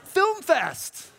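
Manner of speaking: high punchline through a laugh; giggling callback. Real, not acted. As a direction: crack up on it.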